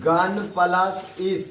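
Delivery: slow, spaced-out dictation of गन plus इस. Shown as a demonstration. Teacher to pupils writing it down, slow dictation. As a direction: spell out गन plus इस slowly so pupils can write. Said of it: "चलो, दूसरा example लिखना".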